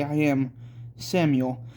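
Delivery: ordinary delivery, nothing marked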